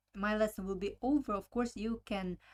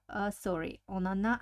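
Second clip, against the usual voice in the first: usual speaking voice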